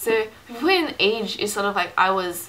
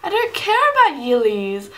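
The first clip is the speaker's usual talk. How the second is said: slow, loud and higher, every word pressed; loud retort; 6 semitones higher, 9 dB louder than usual. Retold or imitated. Answering the boyfriend's excuse, a slow loud dismissive retort. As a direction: brush off his excuse with force.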